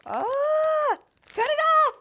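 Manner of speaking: very high, loud flustered squeal; overwhelmed squeal. Real, not acted. Overwhelmed by proposal, begs to stop filming.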